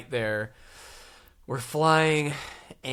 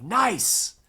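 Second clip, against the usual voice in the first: pleased nice; 7 semitones higher than usual. Retold or imitated. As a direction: celebrate the empty seat with a bright "Nice.". Doors close, empty seat.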